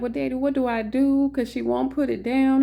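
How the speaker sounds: high, upset plea to daddy; having a fit. Retold or imitated. Daughter on the phone begging her daddy for help, upset. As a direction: beg for help, half panicking. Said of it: "having a fit".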